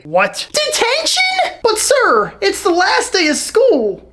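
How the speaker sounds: high, squeaky disbelief; hammed-up schoolboy dismay; shocked protest. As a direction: protest to the teacher in horror. Pupil hears of detention.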